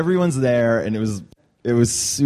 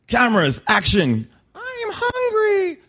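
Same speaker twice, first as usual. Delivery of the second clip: mock Big Bird. Retake calls and Big Bird line.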